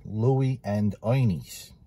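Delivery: slow Bronx pronunciation, "Ernie's" as "Oynie's"; Bronx accent. Shown as a demonstration. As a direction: draw out the name in Bronx speech. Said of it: "you gotta say it the Bronx way".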